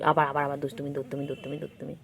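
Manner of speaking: usual speaking voice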